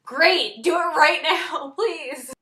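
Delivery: loud, high, desperate yell; screaming in pain. Retold or imitated. Patient in pain begging nurse for drugs, screaming. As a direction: re-enact a patient shrieking for relief. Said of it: "I'm screaming my head off in pain".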